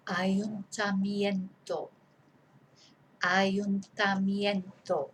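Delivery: slow, each syllable clear; drawn-out dictation word. Shown as a demonstration. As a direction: dictate it slowly to the class. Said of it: "dictation, slowed down".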